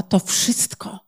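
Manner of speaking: softly imitated broken voice; understated, hushed heartbreak; breaking voice. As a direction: retell her reply quietly, voice dropping away. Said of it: "załamującym się głosem powiedziała".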